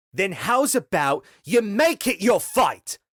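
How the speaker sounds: high, loud furious scream. Acted. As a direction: scream a challenge at them. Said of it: "she screamed to them all".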